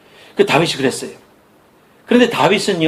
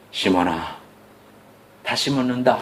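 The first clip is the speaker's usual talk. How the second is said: lower, softer, patient voice of Jesus; a hint of a tender master asking again; gentle asking; 7 semitones lower, 8 dB quieter than usual. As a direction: ask once more, gently. Jesus asks a third time.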